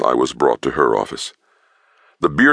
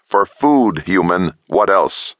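predatory taunt; 6 semitones higher, slower than usual. Acted. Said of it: "She bared her teeth".

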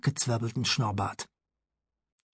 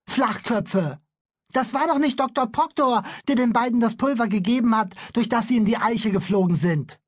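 put-on indignant boy; 7 semitones higher than usual. Bülle protests at the police.

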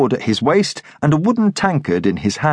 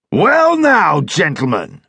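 dragoon leader; 3 semitones higher, slower than usual. Acted. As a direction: hail the stranger like a rowdy officer.

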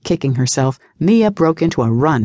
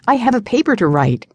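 higher-pitched, hurried-sounding blurt; blurted excuse; 7 semitones higher than usual. A narrator's reading. Mia grasps for an excuse to escape, blurting. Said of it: "she blurted out".

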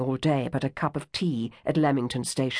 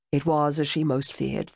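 usual speaking voice

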